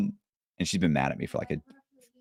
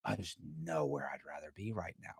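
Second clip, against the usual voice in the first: hushed inner thought; 3 semitones lower, 18 dB quieter than usual. Retold or imitated. Inner thought in happy moment.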